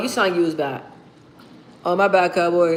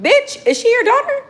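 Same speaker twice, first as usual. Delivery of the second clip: mock outrage; 3 dB louder than usual. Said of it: "I'm like".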